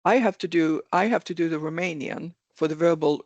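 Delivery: normal talking voice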